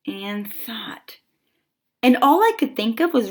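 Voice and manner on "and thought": trailing into a whisper. A narrator's reading. Narrator thinking alone, fading into a whisper. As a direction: let the last word fade to breath. Third repeat fades to whisper.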